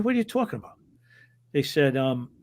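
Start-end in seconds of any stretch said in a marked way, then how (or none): 0.0-0.8 much higher, baffled question; startled. Retold or imitated. Referee answering the threatening fans, a high, startled question. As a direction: caught off guard by a crazy demand.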